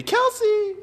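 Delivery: sudden high, loud delighted call of the name; excited shout. Real, not acted. Greeting a friend spotted in the livestream chat, excited shout.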